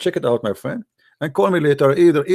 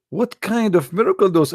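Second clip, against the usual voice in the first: ordinary delivery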